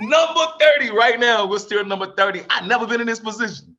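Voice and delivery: high, loud, thrilled disbelief; overjoyed. Real, not acted. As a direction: shout the good news to the sky. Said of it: "I could cry".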